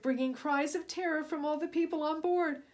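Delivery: usual speaking voice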